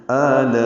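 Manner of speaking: the first vowel of آلائی chanted and held beyond four counts; long madd demo. Shown as a demonstration. Teacher chants آلائی to show the long connected madd. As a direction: sing out آلائی with a long stretched aa. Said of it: "چار حرکات سے زیادہ لمبا کرتے ہیں".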